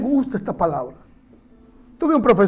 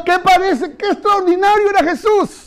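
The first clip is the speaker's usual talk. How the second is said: normal talking voice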